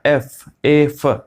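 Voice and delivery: एफ़ split slowly into ए and फ; slow sound breakdown. Shown as a demonstration. Teacher showing the class the vowel sound, slowly splitting ए फ. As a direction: stretch out ए फ sound by sound. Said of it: "देखिए ध्यान से सुनिए".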